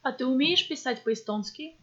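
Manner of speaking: ordinary delivery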